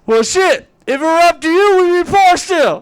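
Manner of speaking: high, loud, indignant retort; retold argument at near-yelling volume; shouted comeback. Retells his shouted comeback to wife.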